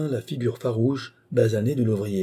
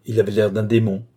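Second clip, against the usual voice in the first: ordinary delivery, nothing marked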